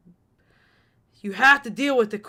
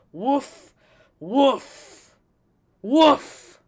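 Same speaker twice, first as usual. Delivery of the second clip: rising louder woof barks; mock barks; 7 semitones higher, 9 dB louder, much slower than usual. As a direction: bark it like a dog. Barks at the game's decline.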